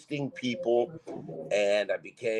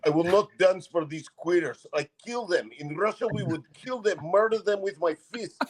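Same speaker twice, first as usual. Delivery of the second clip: mock Russian accent; 7 semitones higher than usual. Imitating a homophobic Russian stripper, higher Russian accent. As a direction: ham up a tough Russian army guy in a Russian accent. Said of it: "And he was like".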